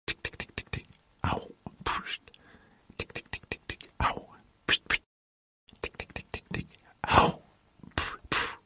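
whispered tik tik and ouch of a little mouse; mouse sounds. Acted. Little brother telling a Christmas story, whispering the mouse's ticking and ouch. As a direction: whisper it like a tiny mouse with burnt paws. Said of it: "hørte han en mærkelig lyd, der sagde".

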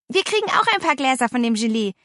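excited call. A narrator's reading. Girl calling out news of the jelly, excited. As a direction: chime in eagerly. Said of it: "rief Paula".

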